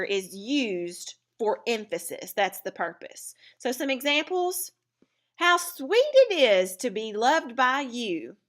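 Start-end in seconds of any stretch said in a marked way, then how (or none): 5.4-8.4 slower, higher and louder, leaning on the feeling; emphatic exclamation. Shown as a demonstration. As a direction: savour the words with delight. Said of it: "we did it to emphasize sweet".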